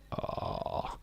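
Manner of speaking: normal talking voice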